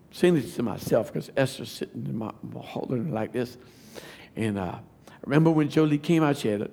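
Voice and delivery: usual speaking voice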